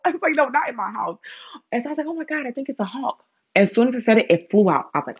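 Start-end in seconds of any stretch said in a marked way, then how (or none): 0.3-3.2 high, hushed worried voice; mock-frightened high voice; nervous alarm. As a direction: sound spooked and unsure. Panicked realization in retold scene.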